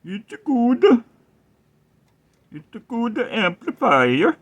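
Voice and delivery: slow, high mock Swedish Chef; Swedish chef accent. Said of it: "like the Swedish chef from the Muppets".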